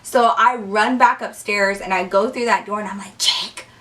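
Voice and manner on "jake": low-key, whispery call of a name; hushed call. Retold or imitated. Calling friend from the stairwell door, hushed. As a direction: call him under your breath. Calling for Jake, first try.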